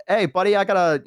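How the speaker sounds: quick friendly Boston "hey buddy"; Boston accent. Retold or imitated. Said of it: "they had, like, a Boston accent".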